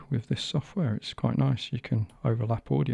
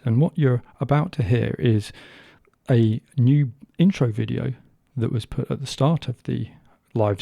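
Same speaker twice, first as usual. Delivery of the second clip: podcast intro read; 5 dB louder than usual. Acted.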